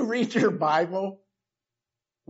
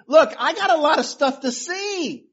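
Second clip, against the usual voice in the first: mildly irritated man; 6 semitones higher than usual. Retold or imitated. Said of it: "the man's getting irritated".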